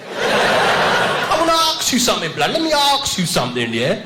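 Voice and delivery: high, swaggering street slang; mock London roadman; roadman kid.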